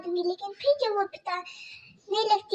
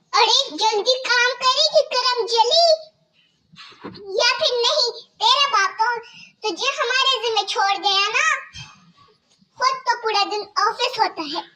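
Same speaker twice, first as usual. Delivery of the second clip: higher, louder mocking stepmother voice; harsh stepmother scolding; 4 semitones higher, 5 dB louder than usual. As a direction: bark orders at the girl like a cruel stepmother. Stepmother taunts.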